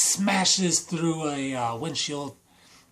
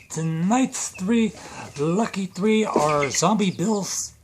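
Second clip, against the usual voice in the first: loud, raised show-opener voice; announcer intro; 3 semitones higher, 6 dB louder than usual. Acted. Video intro announced to viewers.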